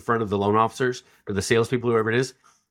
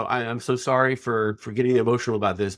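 ordinary delivery

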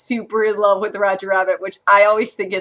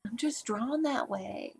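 very soft, half-hearted sultry singer; attempted nightclub singer voice; 16 dB quieter than usual. Cohost trying Jessica Rabbit's line, attempted sultry voice. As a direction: murmur a sultry line, badly faked. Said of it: "I can't do the voice".

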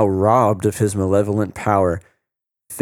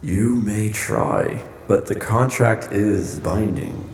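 sinister host voice. Acted. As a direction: draw out the command like a dark host. Host gloats over trapped guests.

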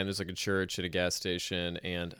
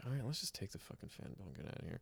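much softer, quick conspiratorial hush; hushed aside; 2 semitones lower, 17 dB quieter, faster than usual. Retold or imitated. Teens plotting to steal the fan belt, hushed.

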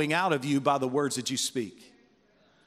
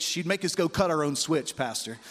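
ordinary delivery

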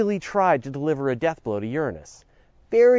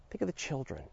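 soft, hushed mock plea; quietly imploring, tongue in cheek; 14 dB quieter than usual. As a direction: drop to a small, pleading near-murmur. Mock plea after the jokes.

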